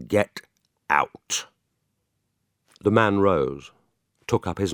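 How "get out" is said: slow, cold and firm; spaced out with a pause; deliberate. Acted. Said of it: "said in a deliberate tone".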